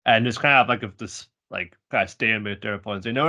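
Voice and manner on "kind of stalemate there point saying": ordinary talk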